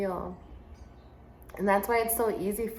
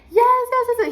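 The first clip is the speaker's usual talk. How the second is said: much higher, louder triumphant yes yes; child cheering; 10 dB louder than usual. Retold or imitated. Boy reaching the top of the wall, high thrilled cheer. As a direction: squeal it like a kid who made it.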